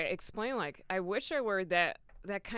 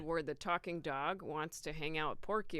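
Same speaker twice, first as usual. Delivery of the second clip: ordinary talk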